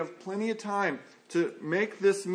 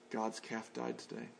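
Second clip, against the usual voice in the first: much lower and much softer, mock dejected farmer; 8 semitones lower, 15 dB quieter than usual. Farmer telling his wife the calf died, hammed-up dejected. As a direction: let it sink, deadpan gloom for the punchline. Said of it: "he came in so dejected".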